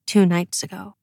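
usual speaking voice

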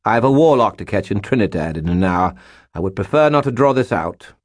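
British lord in a hurry; clipped-tempo British impatience; impatient British accent. A narrator's reading. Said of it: "He paused ... and added".

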